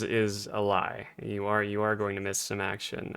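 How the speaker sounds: usual speaking voice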